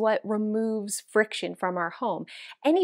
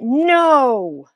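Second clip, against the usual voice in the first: louder, firm no; a loud decisive no; emphatic no; 3 semitones higher, 9 dB louder than usual. Real, not acted. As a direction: shut it down decisively. Decides against keeping shirt.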